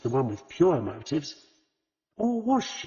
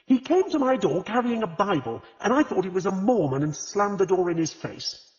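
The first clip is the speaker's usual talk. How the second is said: indignant; 7 semitones higher, 4 dB louder than usual. A narrator's reading. Agatha's indignant outburst continues.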